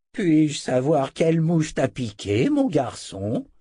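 put-on high, slow old man's voice; indignant old wizard. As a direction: question the boy with drawn-out indignation. Old wizard questions his attacker. (vieux sorcier indigné)

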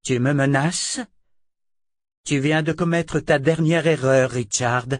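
sentence furieuse, plus aiguë et forte; menace furieuse. A narrator's reading. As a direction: condamner sans appel. Verna menace Richard. (Verna menace Richard de mort)

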